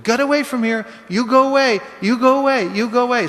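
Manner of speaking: high, loud yell through the door; frightened old lady yelling. Retold or imitated. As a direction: shout him away from the keyhole. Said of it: "she kept going".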